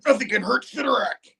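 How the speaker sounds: high put-on boastful villain; lightly voiced smug tyrant; arrogant villain. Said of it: "he's like".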